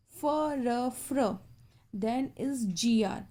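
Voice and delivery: normal talking voice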